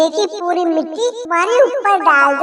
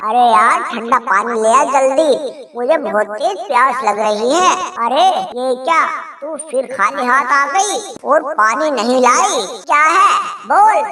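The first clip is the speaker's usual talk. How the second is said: lower, testy thirsty husband; husband demanding water from wife, impatient; 4 semitones lower than usual. Acted. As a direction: hurry her up, lower voice.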